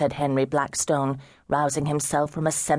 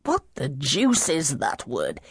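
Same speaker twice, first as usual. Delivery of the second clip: put-on slow, slurred tipsy young gentleman, groping for words; drunken slur; 3 semitones higher, slower than usual. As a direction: drag it out like a man far gone in drink. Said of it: "You are three sheets to the wind".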